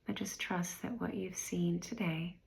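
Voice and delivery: guided meditation. Real, not acted. Meditation guide inviting listeners to review the year, unhurried and calm. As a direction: guide them gently inward, leaving space after each phrase.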